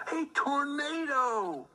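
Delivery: high, slow cry of alarm; alarmed exclamation. A narrator's reading. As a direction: sound the tornado warning, high and slow. Narrator dramatizes tornado.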